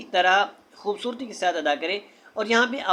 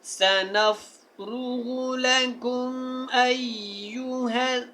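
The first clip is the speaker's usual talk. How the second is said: slow melodic chanted tilawat; Quran recitation; 3 semitones higher, much slower than usual. Shown as a demonstration. Teacher recites the passage from verse 24 for listening.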